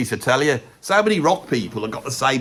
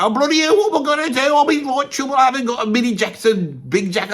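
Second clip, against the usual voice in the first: mock panic; 10 semitones higher than usual. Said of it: "they go".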